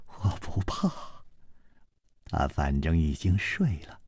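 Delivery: ordinary delivery, nothing marked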